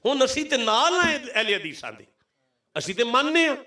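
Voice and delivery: high, swooping sermon appeal; impassioned preaching. Real, not acted. Preacher appealing to rival sects to accept his point, impassioned. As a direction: press the argument on the whole congregation.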